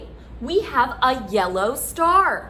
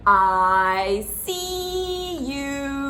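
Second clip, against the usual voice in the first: slow; much slower than usual. Shown as a demonstration.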